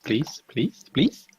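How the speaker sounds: rapid-fire begging, climbing at the end; playful pleading. Pretend. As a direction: plead with the dice. Begging the dice for persuasion.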